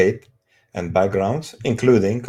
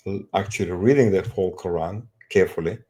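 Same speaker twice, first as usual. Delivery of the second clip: ordinary talk, nothing marked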